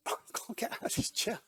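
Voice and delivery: hushed, voiceless retold thought; whispered struggle. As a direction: whisper it as if stuck and straining. Stuck in wheelchair, desperate thought.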